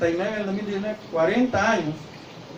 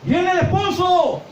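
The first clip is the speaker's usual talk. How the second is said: grito de aviso; 13 semitones higher, 4 dB louder, slower than usual. Acted. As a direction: shout it out high and slow. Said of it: "la voz que grita". (grito agudo y lento anunciando al esposo)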